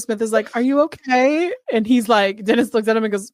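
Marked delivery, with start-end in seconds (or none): 0.6-1.5 high, gentle concern; lightly mock-caring ask. Detective asks sobbing suspect.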